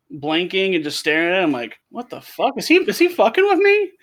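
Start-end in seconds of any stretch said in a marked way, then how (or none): 1.9-3.9 baffled fisherman. Retold or imitated. Fisherman's baffled inner reaction.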